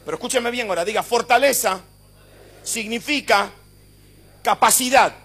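loud, high, fervent preacher driving every line home; full-force preaching. Acted. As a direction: preach it at full force to a crowd answering amen.

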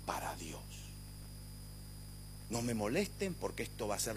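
low, soft, measured pulpit voice; hushed preaching. Acted. Preacher asking the church to imagine a changed Latin America, hushed and solemn. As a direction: draw the congregation in quietly, pitched well down.

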